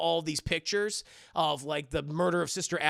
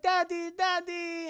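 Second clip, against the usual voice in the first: put-on much higher, slow childish whine; whiny little girl; 11 semitones higher, slower than usual. Esther whining for daddy at night, high and slow. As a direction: plead like a hurt child.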